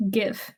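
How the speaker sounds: gif with G as in gift; hard G. Real, not acted. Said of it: "I say".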